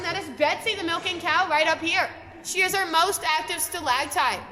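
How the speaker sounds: ordinary talk, nothing marked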